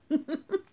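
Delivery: high mock kid giggle; a little giggle like naughty pupils. Narrator imitating giggling pupils in class.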